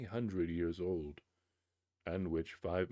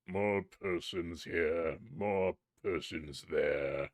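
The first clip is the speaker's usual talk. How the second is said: low, very slow sneering echo; mock the boy's words slowly; mocking spirit; 3 semitones lower, slower than usual. Spirit mocking the boy, low and slow.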